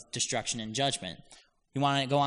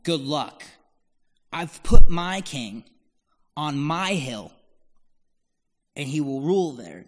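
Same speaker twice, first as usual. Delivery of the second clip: God's scoffing reply; 3 semitones higher, 12 dB louder than usual. Pretend. He laughs at them and says.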